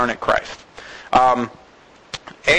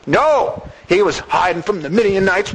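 ordinary delivery

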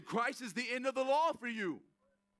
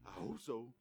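much softer, lower, anxious murmur; worried doubt; 8 semitones lower, 13 dB quieter than usual. Retold or imitated. Believer at heaven's gate unsure he'll get in, timid murmur. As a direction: fade into timid uncertainty. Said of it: "He didn't want you to get up there going".

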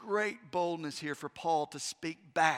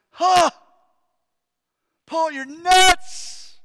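loud scoffing yell, slow and much higher; mocking shout; 10 semitones higher, 18 dB louder, much slower than usual. Governor scoffing loudly at Paul, a derisive shout. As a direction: throw the words at him in contempt. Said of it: "Festus responds and says".

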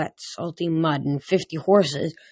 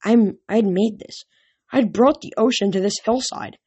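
usual speaking voice

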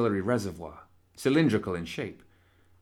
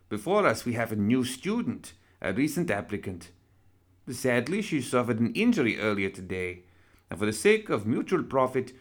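broadcast lecture; 3 semitones higher than usual. Acted. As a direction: address the audience like a showman host. Broadcasts lecture over microphone.

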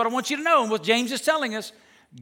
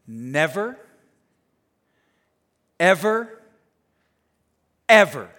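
"Never, ever, ever", each word alone between long pauses; low rising "Never", then punch each "ever" after a silence; emphatic separated words; 4 semitones lower, 3 dB louder than usual. Real, not acted. Preacher letting the verse sink in, weighty emphasis.

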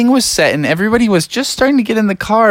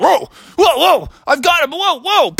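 high alarmed whoas; startled whoa; 11 semitones higher than usual. Acted. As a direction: juggle falling bikes in a panic. Father Frog catching falling bikes.

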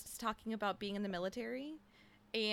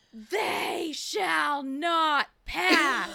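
loud, very slow, drawn-out proclamation; hammed-up yell; 5 semitones higher, 8 dB louder, much slower than usual. The Inquisitor halting the council, hammed-up slow yell. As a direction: boom each word out like a mock decree. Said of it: "slams his staff down and he yells".

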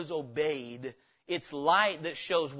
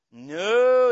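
ordinary delivery

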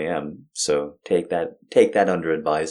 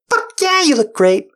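much higher, louder excited cheer; mock enthusiasm; 6 dB louder than usual. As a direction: cheer it like a hyped-up buddy. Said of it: "the real life friend's like".

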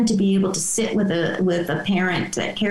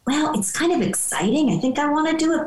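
higher pitch, mildly excited imagined reply; tentatively excited teen; 7 semitones higher than usual. Acted. Teen answering a parent about how it feels, mildly excited. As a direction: voice a teen's cautious excitement. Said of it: "how do they feel about it".

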